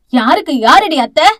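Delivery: loud furious demand, stress on Yaaradi; angry shout. Acted. Aunt shouting at the girl who calls her aunt, furious. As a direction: snap Yaaradi at her in rage. Raji confronts Thalir angrily.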